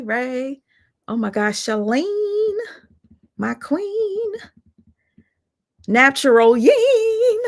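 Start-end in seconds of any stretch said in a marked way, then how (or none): 1.6-7.4 high, excited shout-out of names; squealing hello to fans; excited greeting. Real, not acted. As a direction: sing out their names with glee.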